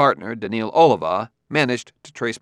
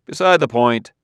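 impatient; 7 dB louder than usual. A narrator's reading. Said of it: "said Bailey impatiently".

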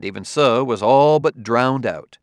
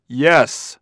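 short shouted yes, higher and louder; 4 semitones higher, 7 dB louder than usual. A narrator's reading. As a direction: fire it straight back at him. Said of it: "shouted Bailey".

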